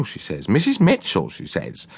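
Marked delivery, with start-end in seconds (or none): 0.5-1.3 imitating a lady. Cook imitates Miss Cartell.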